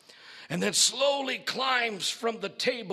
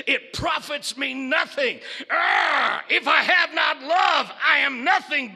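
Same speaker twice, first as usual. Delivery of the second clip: higher, loud roar; bellowing creature; 6 semitones higher, 8 dB louder than usual. Acted. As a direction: bellow it with fury. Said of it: "bellowed the creature".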